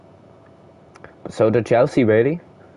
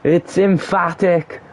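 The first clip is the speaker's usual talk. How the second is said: goal celebration call; 9 semitones higher, slower than usual. Real, not acted. Commentator celebrating the equaliser from the spot, slow high jubilant call. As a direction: savour the goal, drawing every word out.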